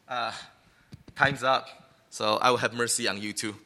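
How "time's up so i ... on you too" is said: ordinary talk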